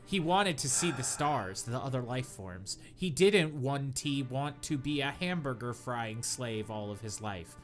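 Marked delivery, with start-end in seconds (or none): none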